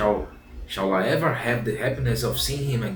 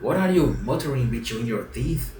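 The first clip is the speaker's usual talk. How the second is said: ordinary delivery, nothing marked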